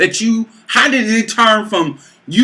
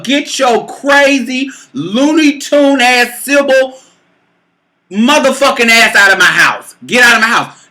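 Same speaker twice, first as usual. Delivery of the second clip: loud, raised-pitch tirade; furious eviction; 6 semitones higher, 4 dB louder than usual. Retold or imitated. Woman throwing crazy lover out of her house, yelling. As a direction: order him out, building to a shout.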